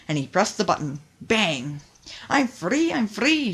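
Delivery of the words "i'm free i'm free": high, joyful cry of freedom; freed log calling out through the smoke; shouted. A narrator's reading. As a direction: call out in relief. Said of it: "shouted Sunshine through the smoke".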